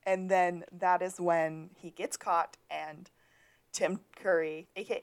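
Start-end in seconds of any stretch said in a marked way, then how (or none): none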